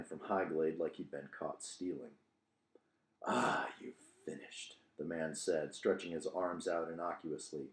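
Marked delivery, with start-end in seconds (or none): 3.2-4.8 hushed stranger. Acted. Hooded stranger caught, speaks low.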